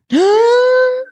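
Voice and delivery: high, loud mock gasp; a big theatrical intake of breath; dramatic gasp. Reacting to the twist with a shocked gasp.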